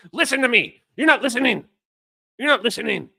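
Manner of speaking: high, loud mock indignation; mocking imitation. Streamer mimicking the arguer's demand to listen, mocking. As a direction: parody someone insisting on being heard. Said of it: "how do people actually talk like this".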